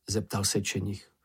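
usual speaking voice

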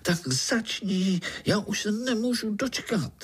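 very high, excited little voice; eager little spider. A narrator's reading. Young spider begs uncle to start, eager. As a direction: plead impatiently in a high voice. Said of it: "Pája vyskočil".

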